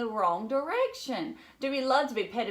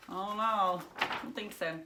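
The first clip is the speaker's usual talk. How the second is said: plain reading voice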